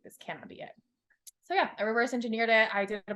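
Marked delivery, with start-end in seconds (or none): 0.0-0.7 much softer and lower, hollow; quiet despair. Retold or imitated. She crossed the finish line and feels empty, murmured. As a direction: say it to yourself, drained. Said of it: "I was like".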